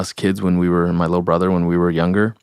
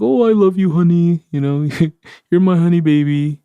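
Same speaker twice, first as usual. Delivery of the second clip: high, louder, sugary imitation of a loving husband; doting grandpa; 12 semitones higher, 6 dB louder than usual. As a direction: coo it like an adoring old man to his wife. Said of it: "And he'd be like".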